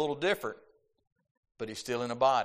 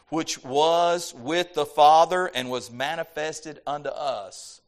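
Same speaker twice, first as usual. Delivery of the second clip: louder, higher preacher's reading voice; emphatic scripture reading; 3 semitones higher, 5 dB louder than usual. Real, not acted. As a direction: proclaim the verse with weight on key words. Reads scripture aloud with emphasis.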